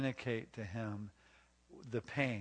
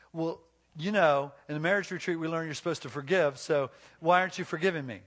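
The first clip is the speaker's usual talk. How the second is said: louder, higher, self-righteous demand; mock indignant husband; 4 semitones higher, 7 dB louder, faster than usual. Husband demanding forgiveness from his hurt wife, mock indignant. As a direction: push it out as a hammed-up complaint.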